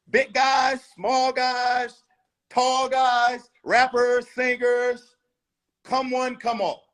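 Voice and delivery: loud, high call to all comers; calling out challenge. Acted. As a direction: shout it like a fight promoter. Challenge called out to everyone.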